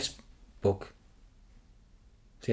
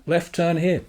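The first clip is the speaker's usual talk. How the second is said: mock sat-nav voice, higher and louder; imitating Siri; 6 semitones higher, 4 dB louder than usual. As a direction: do the phone's robot directions. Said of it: "you've got Siri or whatever".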